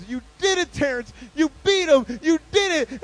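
high, loud congratulations; acted proud, excited shouting; jubilant mom. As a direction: cheer for your child's victory. Mom celebrates the win.

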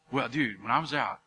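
low, hushed, slowing mock brag; fisherman's brag. Fisherman telling his buddy a fish story, hushed brag. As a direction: understate the tall tale almost to a whisper. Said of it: "been with two fishermen".

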